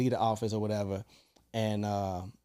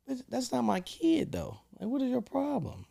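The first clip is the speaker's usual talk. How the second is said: outraged high retort; 13 semitones higher, 5 dB quieter than usual. Retold or imitated. Man retelling his answer to the mother, exasperated high voice. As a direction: spell out his outrage, pitched up.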